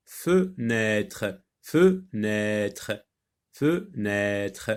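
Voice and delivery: "fenêtre" slowly broken into fe-nê-tre; syllable by syllable. Shown as a demonstration. Teacher helping pupils hear the sound, syllable by syllable.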